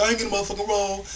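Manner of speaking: lightly acted irritation; pissed. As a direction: imitate an annoyed customer, rattled off fast.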